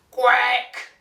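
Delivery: "Quack" with an E vowel, like kweck; mispronounced quack. Shown as a demonstration. Duck testing the hen's letter, "Quack" loudly turned into kweck.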